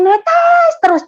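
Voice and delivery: "netes" stretched long, high and loud; drawn-out NETES. Retold or imitated. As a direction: draw out "netes" loudly to show tears pouring. Vivid retelling of tears pouring.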